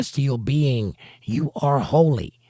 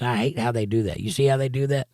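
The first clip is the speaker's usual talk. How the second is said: ordinary talk, nothing marked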